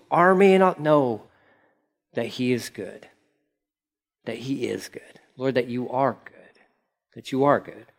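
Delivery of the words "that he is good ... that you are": dropping to a soft whisper; hushed prayer. Real, not acted. Pastor praying over the church, in a whisper. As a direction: breathe the words to God.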